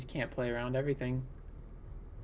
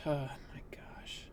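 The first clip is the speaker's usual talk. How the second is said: slow, breath-only murmur of disbelief; dismayed whisper; 10 dB quieter, much slower than usual. Real, not acted. Player facing a sudden loss, whispering in dismay. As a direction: let it sink out in a whisper. Dismayed at likely losing the game.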